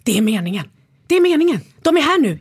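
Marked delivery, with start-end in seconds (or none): 1.1-1.6 high, loud burst of excitement; excited. Retold or imitated. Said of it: "och så bara". 1.8-2.4 high and loud, giddy; excited. Retold or imitated. Retells thrill at seeing the team.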